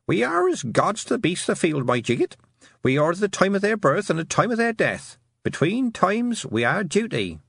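put-on high, old-fashioned country voice; Granny Aching. A narrator's reading. Shepherd grandmother instructs a crying girl, old-fashioned speech. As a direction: intone it as an old-fashioned saying, pitched high. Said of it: "an old-fashioned way of speaking".

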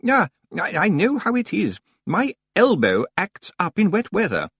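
high, halting librarian voice; sympathetic senior librarian. Acted. Senior librarian sympathizing with stranger, high and hesitant. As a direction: commiserate politely, pitched high.

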